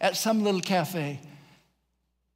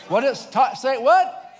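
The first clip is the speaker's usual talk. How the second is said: loud, high prompt to the crowd; calling out; 8 semitones higher, 8 dB louder, slower than usual. Real, not acted. Preacher asks congregation about the McDonald's smell, calling out. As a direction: push the question up high at the end.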